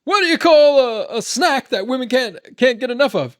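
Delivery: high, loud comic voice; comedian impression. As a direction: ham up the punchline loudly. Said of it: "He's like".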